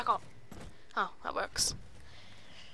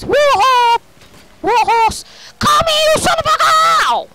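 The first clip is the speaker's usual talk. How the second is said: high, loud battle cries; excited yelling; 12 semitones higher, 10 dB louder than usual. Real, not acted. Gamer chasing someone in the game, wild yelling. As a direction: yell it wildly at the enemy. Gamer shouts during the chase.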